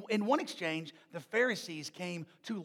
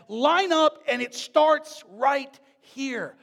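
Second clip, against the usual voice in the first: slow, loud, higher teacher instruction; kindergarten teacher; 5 semitones higher, 8 dB louder, slower than usual. Retold or imitated. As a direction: marshal small children into line. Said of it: "the kindergarten classroom teacher said".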